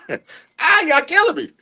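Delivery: high-pitched mock cracking-up voice. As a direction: squeal it like you're cracking up, retold. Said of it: "I'm cracking up like".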